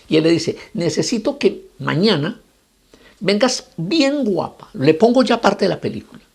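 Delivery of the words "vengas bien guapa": "bien guapa" drawn out slowly and higher; emphatic instruction. Acted. Rabbi tells the woman to return dressed up, emphatic. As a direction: stretch "bien guapa" with relish.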